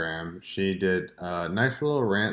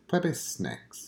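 slow ad slogan; 2 semitones higher, 8 dB quieter, slower than usual. Shown as a demonstration. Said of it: "now we're just back to bars?".

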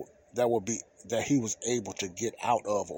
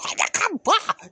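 high laugh; 6 dB louder than usual. Real, not acted. Laughing at his fight picture.

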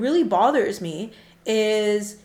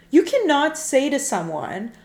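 ordinary delivery

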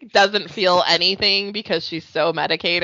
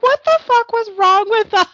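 high, loud disbelief; exasperated outburst; 13 semitones higher, 5 dB louder than usual. Retold or imitated. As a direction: throw it up in disbelief. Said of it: "I'm like".

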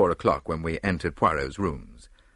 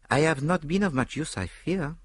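put-on mildly regretful, higher French-accented voice; a touch of polite regret; 9 semitones higher than usual. A narrator's reading. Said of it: "he said regretfully".

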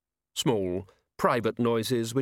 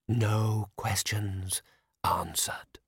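monotone ghost; much slower than usual. A narrator's reading. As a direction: drag it out, dead flat. The spectre speaking.

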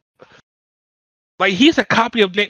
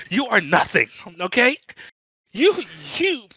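ordinary delivery

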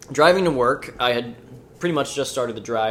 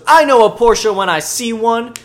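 slow, high, loud insistence; mock a pushy stranger; insistent shout; 10 semitones higher, 10 dB louder than usual. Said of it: "And she goes".

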